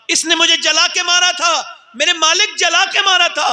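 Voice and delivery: a mother crying out her accusation; pleading mother. Acted. Mother accusing her son before God, wailing high.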